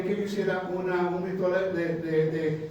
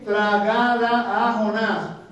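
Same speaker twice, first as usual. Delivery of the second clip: drawn out; 2 semitones higher, 5 dB louder, much slower than usual. Acted. Preacher drawing out answer.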